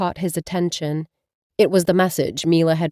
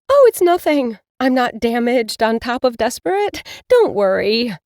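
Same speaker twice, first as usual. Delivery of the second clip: higher, louder brave front; upset young witch; 5 semitones higher, 4 dB louder than usual. Acted. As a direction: wave it off, hiding the hurt. Mila upset with her cat.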